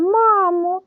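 high mock cry for mama. A narrator's reading. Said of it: "Пух кричав". (вигук)

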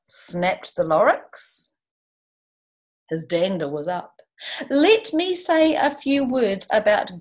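lively read-aloud rhyme; storybook reading voice; story narration. A narrator's reading. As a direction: read it to a class with bounce. Reads Dr. Seuss aloud.

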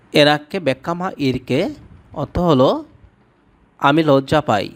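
ordinary delivery

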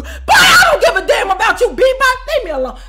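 high, loud, sobbing yell; begging Oz to go away; crying shout. Retold or imitated. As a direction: scream it near tears. Yelling at coworker Oz.

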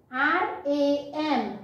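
very slow, each letter drawn out; slow letter spelling. Shown as a demonstration. Teacher spelling for children, very slow. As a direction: stretch every letter for the class. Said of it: "next છે".

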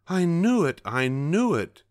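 high, loud happy cry; gleeful cry. A narrator's reading. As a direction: cry out with glee. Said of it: "she gleefully cried".

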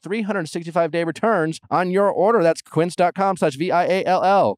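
polished promo announcer delivery; podcast ad read. Acted. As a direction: sell it clearly, every web address letter distinct. Reads sponsor ads.

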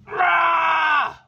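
loud, high, drawn-out yell; long high holler of effort; frustrated yell. Real, not acted. Yell while fighting the stud.

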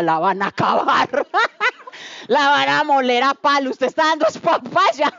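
half-laughing mock warning; voice of the flesh. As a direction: tease like a doubting inner voice. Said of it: "la carne decía".

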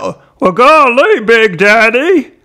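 high, loud Southern cartoon monster; Slurp. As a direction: stretch it out in a hammed-up Southern drawl. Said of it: "Right, that's Slurp".

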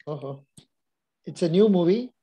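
ordinary talk, nothing marked

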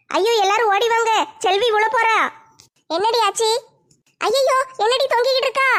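ordinary talk

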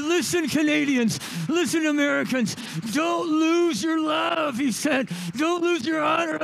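high, loud preaching cry; rally the crowd with full force; impassioned loud plea. Retold or imitated. Canadian father calling out to the nation, loud and impassioned.

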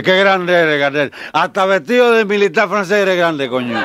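high, loud tipsy exclamation; drunken exclamation. Acted. Drunk toasts the Napoleon portrait, loud high delight.

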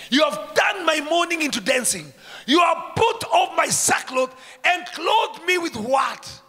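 loud high rejoicing shout; joyful preaching. Acted. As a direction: proclaim it with joy.

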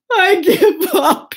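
high, loud, hammed-up sobbing; mock-crying defeated bear; blubbering. A narrator's reading. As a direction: wail it in slow, broken sobs. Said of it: "he blubbered".

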